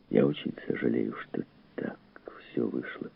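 soft, low apology fading to breath; hushed regret. Acted. Fugitive to the host he has endangered, hushed regret. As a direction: apologise quietly, voice dying away.

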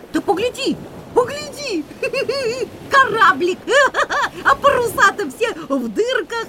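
excited laughing. Acted.

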